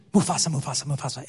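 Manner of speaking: low, hammed-up chant of Mufasa; mock the hyenas calling the name; Lion King hyena quote. Preacher quoting the movie, low hammed-up chant. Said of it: "if you've ever seen The Lion King".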